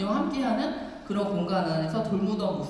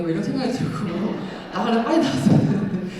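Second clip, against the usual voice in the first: louder, laughing through the words; 5 dB louder, faster than usual. Real, not acted. Nun recounting her doubts about leaving, laughing. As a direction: tell it through an amused laugh. Laughs at own past dilemma.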